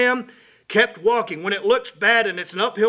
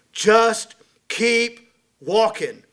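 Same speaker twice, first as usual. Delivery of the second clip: each word drawn out and pressed; slow it right down and press it; preacher driving home the point; slow emphatic refrain; 4 dB louder, much slower than usual. Real, not acted.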